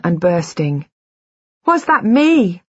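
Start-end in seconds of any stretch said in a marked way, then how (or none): 1.7-2.6 high, loud anguished scream. A narrator's reading. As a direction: scream it in horror. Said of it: "I screamed".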